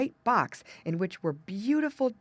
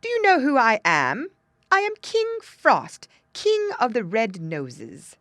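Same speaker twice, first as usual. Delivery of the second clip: loud, high imperious announcement; snapped out; 5 semitones higher, 8 dB louder than usual. A narrator's reading. King Frost introducing himself to the girl, snapped out. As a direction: proclaim your royal title loudly, higher at first. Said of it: "he snapped out".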